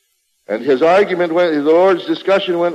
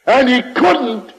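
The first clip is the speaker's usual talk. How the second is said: building preaching cry; 7 semitones higher than usual. Real, not acted. Preacher insisting Jesus would not change his words, rising to a cry. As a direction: pour it out until the voice cracks.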